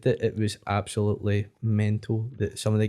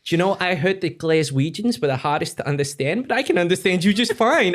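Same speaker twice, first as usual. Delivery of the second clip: lightly voiced New York old ladies, higher; a hint of chirpy New York old dears; New York old lady; 6 semitones higher, 3 dB louder than usual. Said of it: "the woman turned around and just went like".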